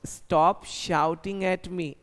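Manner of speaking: very slow, each word laid out for the class; slow model sentence. Shown as a demonstration. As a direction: dictate it slowly like a teacher. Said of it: "मेरे ऊपर चिल्लाना बंद करो". (बहुत धीरे, साफ़-साफ़)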